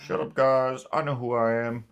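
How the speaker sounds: lightly voiced robot hero; a hint of a gruff robot leader; Optimus Prime voice. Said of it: "Optimus Prime himself".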